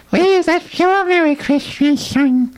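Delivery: very high squeaky chipmunk voice. Retold or imitated. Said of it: "You're supposed to go like".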